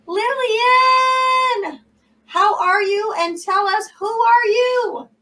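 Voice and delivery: high, loud announcer call; loud host welcome. Real, not acted. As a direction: call her name out like a show host. Host calling out introduction.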